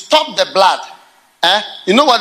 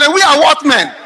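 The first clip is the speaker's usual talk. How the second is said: laughing question; 6 semitones higher, 4 dB louder than usual. Acted.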